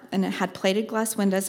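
ordinary talk, nothing marked

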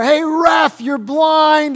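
loud, high heckling yell at the umpire; a fan shouting at the ref. Retold or imitated. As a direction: bellow it from the stands. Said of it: "someone yells out".

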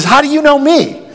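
high, pushy question; blunt challenge to Jesus. Acted. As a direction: throw it at him bluntly. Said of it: "it sounds very blunt".

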